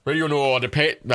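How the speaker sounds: normal talking voice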